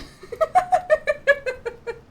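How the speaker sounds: high, loud helpless laughter; high cackling giggles; real laughing fit. Real, not acted. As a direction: crack up at your own joke. Cracks up at own bit.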